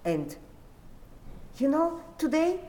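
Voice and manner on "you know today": high agitated telling. Retold or imitated. Retelling terror scene, pitch high.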